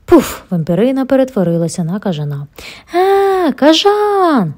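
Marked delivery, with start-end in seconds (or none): 2.9-4.5 high, loud scream at a bat; terrified scream; screaming louder. A narrator's reading. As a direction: shriek even harder than before. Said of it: "закричала ще голосніше Попі".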